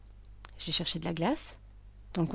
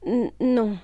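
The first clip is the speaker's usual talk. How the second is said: hesitant admission; 4 semitones higher, 8 dB louder than usual. Acted. Patient admits stopping treatment.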